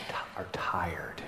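tired whisper; whispered weariness. Acted. Preacher describing tired Angelenos, whispered. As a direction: breathe it out wearily.

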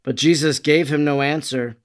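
ordinary delivery, nothing marked

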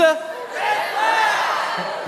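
high shouted red flag. Acted. Big comic red flag call.

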